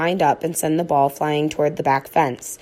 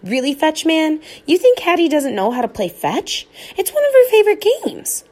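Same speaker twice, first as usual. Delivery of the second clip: put-on girl's higher voice; 10 semitones higher, 3 dB louder than usual. A narrator's reading. Hattie pleading with dad.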